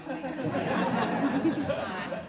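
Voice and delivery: laughing loudly through the words; a loud amused aside, words carried on a laugh. Real, not acted. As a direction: giggle it out to a friend. Joking to Rashida about her son.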